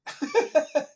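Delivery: high, loud burst of laughter. Real, not acted. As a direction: crack up loudly. Laughs at forgotten point.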